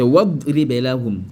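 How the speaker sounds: "wa idribe" run together as wad-ribe; correct linked reading. Shown as a demonstration. Teacher corrects the common mistake, linked reading. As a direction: drop the "i" of "idribe", linking straight from wa. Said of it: "C'est pas wa idribe".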